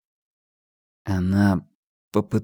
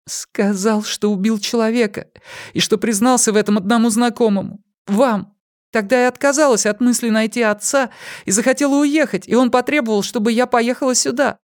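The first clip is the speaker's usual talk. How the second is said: put-on higher, hesitant young woman; 13 semitones higher than usual. A narrator's reading. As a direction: confess timidly as a young woman. Pip voiced by narrator.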